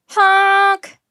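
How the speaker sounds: blaring bus horn imitation; a loud, drawn-out horn blast; loud horn honk. A narrator's reading.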